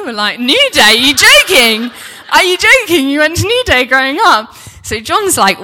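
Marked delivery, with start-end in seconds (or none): 0.4-4.5 very loud, high-pitched disbelief; incredulous outburst. Retold or imitated. Couple hears girlfriend went to New Day, shouting incredulously. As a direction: shout it in thrilled astonishment, voice leaping up. Astonished reaction to camp name.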